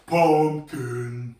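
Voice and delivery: low, slow pumpkin voice. Shown as a demonstration. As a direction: drop the voice for a big pumpkin. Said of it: "go really low voice".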